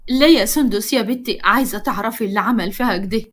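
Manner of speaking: plain reading voice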